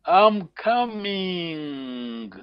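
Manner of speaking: very slow, stretched "I'm coming"; drawn-out prompt. Shown as a demonstration. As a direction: draw out "coming" in a long falling glide for the learner. Teacher gives phrase to translate.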